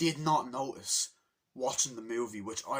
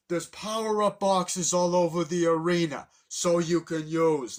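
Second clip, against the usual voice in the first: loud, raised, proclaiming voice; booming mock announcement; imitated arena announcer; 4 semitones higher, 9 dB louder, slower than usual. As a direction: proclaim the rules to a whole stadium. Cranky announces the rules to arena.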